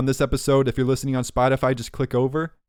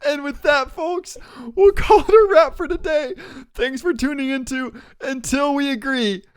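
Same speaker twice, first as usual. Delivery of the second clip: high-pitched, yelled podcast outro; shouted sign-off; 4 dB louder than usual. Acted. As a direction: holler the goodbye to the listeners. Still shouting the show's sign-off.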